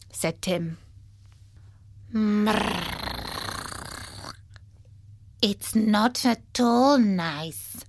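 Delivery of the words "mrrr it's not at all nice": slow, drawn-out feline purr; trill, then complain like a cat; languid, displeased cat voice; talking cat. A narrator's reading. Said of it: "said a cat".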